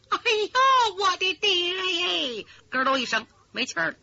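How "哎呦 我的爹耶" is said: grief-stricken lament; wailing daughter. Acted. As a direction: cry out for her dead father. Daughter hears father killed.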